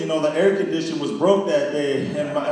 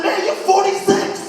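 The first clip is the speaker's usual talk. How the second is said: much higher, slower disbelieving retort; incredulous; 13 semitones higher than usual. Retold or imitated. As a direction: hit him with it in disbelief. Punchline to Eddie.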